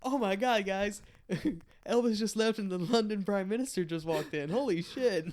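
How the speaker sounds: high excited announcing; mock excited. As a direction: announce like a starstruck fan. Fake announcement again.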